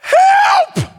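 preacher acting Jonah crying to God; desperate cry. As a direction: scream it from the bottom of the sea.

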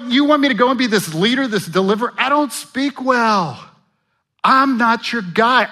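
high, loud whining excuses; whiny Moses. Retold or imitated. Pastor voicing Moses making excuses to God, high and whiny. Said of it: "It's almost like Moses was thinking".

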